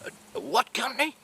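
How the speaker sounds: high-pitched, baffled question; incredulous. Retold or imitated. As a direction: query it in disbelief, pitch jumping up. Boss retells his disbelief.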